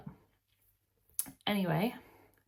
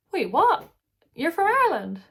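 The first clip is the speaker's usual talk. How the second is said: much higher and louder, astonished; mock surprise; 10 semitones higher, 10 dB louder than usual. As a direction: act stunned at the news. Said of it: "And they're like".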